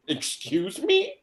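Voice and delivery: high, drawn-out mock indignation; hammed-up shocked offence; taken aback. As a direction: act hugely affronted, pitch shooting up. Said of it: "I was, like, so taken aback".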